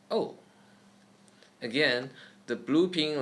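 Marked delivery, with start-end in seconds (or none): 0.1-0.3 O falling sharply, fourth tone. Shown as a demonstration. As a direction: snap O down.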